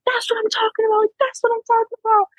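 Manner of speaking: very high, strained mock holler without much volume; imitated yelling. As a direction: hype them up like a yelling friend, squeezed high. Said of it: "I just hear him yelling".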